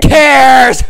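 very loud, high yell; sudden gleeful high shout; shouted punchline. Pretend. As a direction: bellow the punchline with full force.

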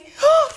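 sharp high surprised gasp; dramatic gasp. Acted. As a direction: gasp at the sudden turn. Suddenly, dramatic story turn.

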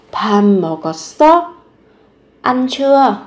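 ordinary delivery

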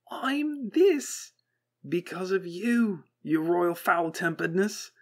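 unhinged cheer; cheery and terrible. A narrator's reading. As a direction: grin through dawning bitterness. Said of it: "Wheatley's voice rose, oh so cheery and quite terrible".

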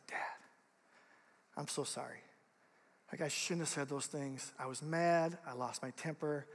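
a son quietly apologizing to his dad; soft apology. Retold or imitated. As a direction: hush it like a sorry little kid. Son's tearful apology retold.

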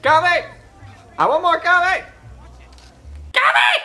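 high-pitched shouting for coffee; mock shout. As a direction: holler it way up high, demanding coffee. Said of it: "Just shout".

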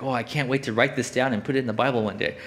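normal talking voice

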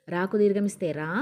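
ordinary talk, nothing marked

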